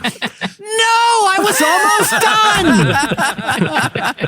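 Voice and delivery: very high, slow, crying shout; wailing actor. Retold or imitated. Actor wailing at the panel, very high crying shout. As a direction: wail it like a tantrum. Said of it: "he's like".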